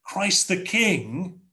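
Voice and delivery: ordinary talk, nothing marked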